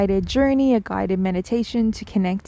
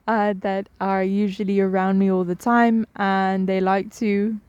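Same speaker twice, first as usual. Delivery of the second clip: ordinary delivery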